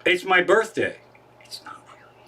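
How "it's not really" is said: breathy whispered confession, almost silent; whispered aside. Real, not acted. Confessing to the listeners that the birthday fib was false, in a whisper. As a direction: drop to a whisper to admit the fib. Aside after fibbing to Alexa.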